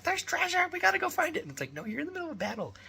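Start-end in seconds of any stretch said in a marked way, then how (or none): 0.0-1.4 put-on high, loud eager child voice; excited kid. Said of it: "my son is like". 1.7-2.7 a hint of a high, fast mock-reasoning voice; lightly voiced parent correcting a kid; dad explaining the rules to his son; patient dad.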